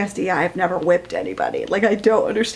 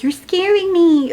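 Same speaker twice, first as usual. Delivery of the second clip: higher, slower mock fear; mock scared; 9 semitones higher, 4 dB louder than usual. Character telling her lover she's scared, higher and slow. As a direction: play it frightened, a bit hammed.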